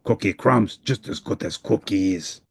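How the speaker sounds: a cheerful cookie lover unbothered; Cookie Monster. Acted. Cookie Monster shrugs off crumbs.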